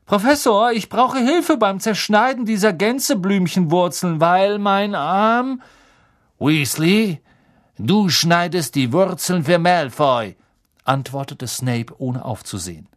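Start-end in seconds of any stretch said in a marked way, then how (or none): 0.1-5.6 high, loud schoolboy complaint; whining injured pupil. A narrator's reading. Malfoy appeals to the professor over his arm, high whine. 6.4-10.3 louder, higher stern order; commanding teacher. A narrator's reading. Snape orders Ron to cut roots, loud and stern.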